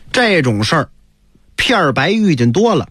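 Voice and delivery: plain reading voice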